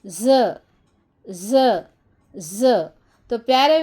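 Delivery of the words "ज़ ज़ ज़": "ज़" as a short buzzing za, not "zed"; letter sound z. Shown as a demonstration. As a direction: sound out "ज़", the last one fading. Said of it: "ये है ज़".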